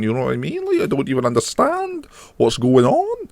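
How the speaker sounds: high, quiet mock Scottish voice; baffled, high-pitched Scottish impression; Scottish accent. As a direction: squeak it in a put-on Scottish accent. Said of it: "quite a mild Scottish accent".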